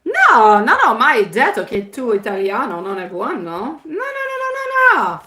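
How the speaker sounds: high squealed no no no; playful protest. Real, not acted. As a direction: deny it with mock alarm. Protesting she never criticised Italian.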